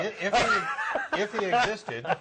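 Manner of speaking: high, laughing through the words. Real, not acted. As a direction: giggle out the start of the sentence. Laughing mid-sentence.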